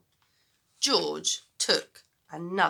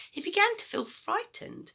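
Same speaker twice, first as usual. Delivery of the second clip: usual speaking voice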